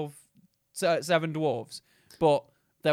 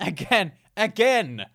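building mock exasperation; 4 semitones higher, 6 dB louder, slower than usual. Mocking the neighbour's kids again.

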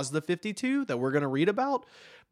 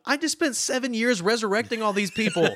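high, louder, laughing mock outrage; incredulous writer; 10 semitones higher, 4 dB louder than usual. A writer protesting a reset of his heroes, high laughing mock outrage. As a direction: play the indignant writer, cracking up. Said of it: "Jeff Johns was probably going".

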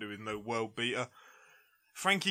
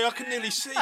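boxing fan voice; 11 semitones higher, 3 dB louder, much faster than usual. Pretend. Joining the drunk fan bit.

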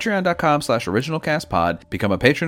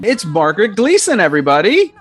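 high, loud show-host announcement; host's guest introduction; 8 semitones higher, 8 dB louder than usual. Real, not acted. Host introducing a theater student guest to listeners, big announcer flourish. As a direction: present the guest loudly, pitched up.

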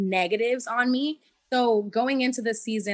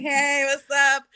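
loud, drawn-out hey, pitched up; hailing greeting; 4 semitones higher, 7 dB louder, slower than usual. Retold or imitated. Injured player limping up to T J, hailing greeting. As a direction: call out a stretched, playful hello.

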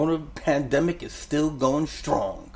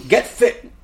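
loud, high laughing shout of the name; laughing announcement; 12 semitones higher, 10 dB louder than usual. Real, not acted. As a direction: blurt it out through a laugh.

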